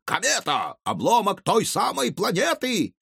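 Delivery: high, ecstatic mad scientist yelling; raving with manic delight. A narrator's reading. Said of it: "вопил он".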